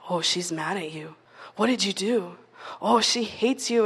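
low, soft needling voice of doubt; nagging inner voice. Retold or imitated. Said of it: "it's like".

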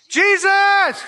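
high, loud call of the name; shouting. Acted. A blind beggar calling Jesus over the crowd, loud shout. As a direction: cry it out to be heard. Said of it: "Everybody help me".

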